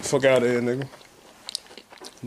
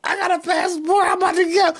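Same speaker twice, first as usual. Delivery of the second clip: shocked outburst; slower than usual. Acted. Someone stunned by a travel plan, crying out high. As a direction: act floored by the news, voice jumping up.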